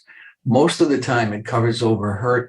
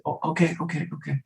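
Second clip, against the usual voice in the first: soothing parent; 3 semitones higher, 8 dB quieter, faster than usual. Retold or imitated. Parent calming child.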